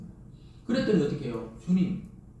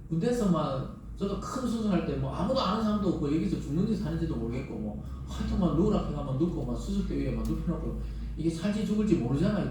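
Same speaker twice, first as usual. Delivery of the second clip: usual speaking voice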